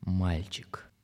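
whispered eerie title. A narrator's reading.

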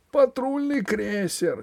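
high, drawn-out alarmed cry; a different voice, cry in alarm; shout from crowd. A narrator's reading. Someone in the hall raising the alarm, high cry. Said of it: "крикнул кто-то в зале".

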